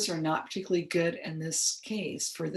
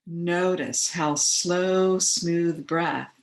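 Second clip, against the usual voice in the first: guided meditation. Acted. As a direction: ease listeners into relaxation, unhurried and measured. Leads guided relaxation.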